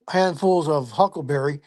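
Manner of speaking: ordinary talk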